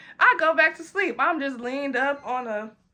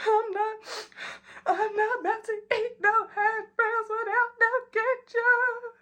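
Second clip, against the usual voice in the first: put-on drunk crying whine; 11 semitones higher than usual. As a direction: draw it out like a sobbing tipsy child. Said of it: "And I'm just crying".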